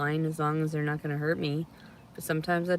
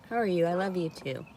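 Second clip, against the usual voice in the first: ordinary delivery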